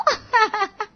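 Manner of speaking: high-pitched burst of laughter; high giggle. A narrator's reading. Young woman joking at the restaurant table, giggling. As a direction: laugh at your own impression.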